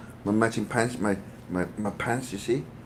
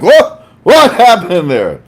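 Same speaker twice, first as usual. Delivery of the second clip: much higher, louder and slow, startled; startled dogs; 9 semitones higher, 12 dB louder, slower than usual. Pretend. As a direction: voice the dogs jumping up in alarm. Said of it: "All the dogs right now like".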